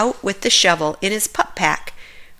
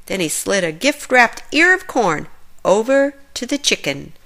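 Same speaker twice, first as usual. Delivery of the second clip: plain reading voice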